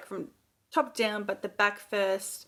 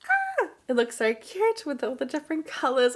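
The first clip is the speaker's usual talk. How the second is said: higher, louder, gushing delight; excited; 10 semitones higher, 5 dB louder than usual. Real, not acted. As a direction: squeal over the swatch with glee. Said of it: "I am so excited".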